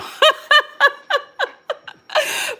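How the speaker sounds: high nervous laugh after admitting something; embarrassed laugh. Real, not acted. Speaker admitting her ears get hot, real embarrassed laugh. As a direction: laugh through the awkward moment. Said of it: "I'm really vulnerable right now, y'all".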